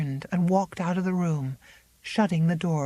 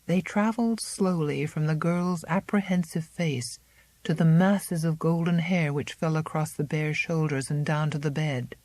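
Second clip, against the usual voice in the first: ordinary delivery, nothing marked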